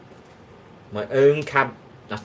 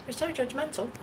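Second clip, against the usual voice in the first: mock retort; 13 semitones higher, 7 dB quieter than usual. Mock retort to chat viewer.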